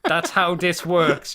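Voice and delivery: loud hammed-up thug threat; playing a tough guy, loud and laughing; mock threat. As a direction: bark it like a pub bruiser. Acting out a thug.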